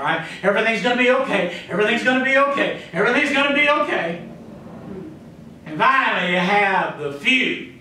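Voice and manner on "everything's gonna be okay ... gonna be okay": rapid-fire insistent reassurance; quick, repetitive mock optimism, climbing in pitch; positive thinker. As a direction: chant it fast, like someone convincing themselves. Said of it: "Those are the positive thinkers".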